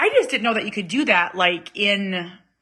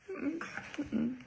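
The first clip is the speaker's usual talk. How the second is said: hummed refusal; 6 semitones higher, 16 dB quieter than usual. Retold or imitated. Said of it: "And I was like".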